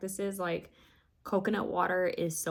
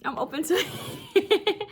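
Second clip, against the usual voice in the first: laughing; 10 semitones higher, 5 dB louder, slower than usual. Real, not acted. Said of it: "I'm being silly".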